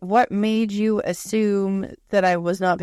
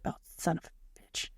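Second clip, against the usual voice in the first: muttered under the breath, much softer; whispered curse; 2 semitones lower, 15 dB quieter than usual. Retold or imitated. Reading vague boss messages, a soft whispered curse. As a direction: hiss it to yourself, annoyed. Reacting to ambiguous statements.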